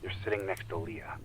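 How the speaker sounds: a calm spiritual guide easing a friend into meditation; guided meditation. Acted.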